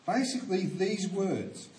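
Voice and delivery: normal talking voice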